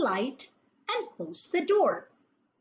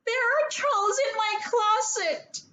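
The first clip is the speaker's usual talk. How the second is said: high, frightened shriek; girl shrieking to her father; 11 semitones higher than usual. A narrator's reading. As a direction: cry it out in alarm. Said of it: "shrieked Penelope".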